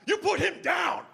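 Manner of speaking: high, slow, commanding shout; Dennis Rodman. Retold or imitated. NBA legend to bouncer, commanding shout. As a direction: order it like a rescuer stepping in. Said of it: "without hesitation, says this".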